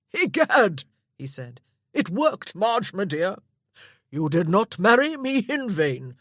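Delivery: loud, booming jolly uncle with a laugh; hearty old lord. Acted. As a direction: boom it out like a hungry, merry old lord.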